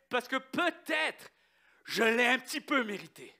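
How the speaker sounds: preacher exhorting the congregation on grace; fervent preaching. Acted. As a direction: drive the lesson home to the church.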